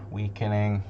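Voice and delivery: ordinary delivery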